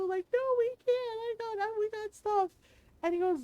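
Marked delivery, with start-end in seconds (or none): 0.3-2.5 crying protest. Retold or imitated. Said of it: "I'm crying and telling Rondo".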